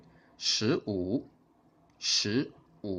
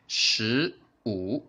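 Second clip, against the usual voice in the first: normal talking voice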